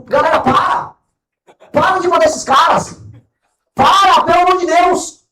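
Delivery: shouted, exasperated plea; loud pleading. Real, not acted. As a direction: beg them loudly to stop. Pleading with crowd to stop.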